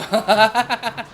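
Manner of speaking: laughing through the words. Real, not acted. Streamer laughing at burning wall.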